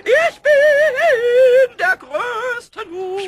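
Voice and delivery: high, loud, drawn-out boast; boastful cry. Acted. Kobold boasting to Eder about his usefulness. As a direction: crow it proudly to the world. Pumuckl's proud boasting outburst.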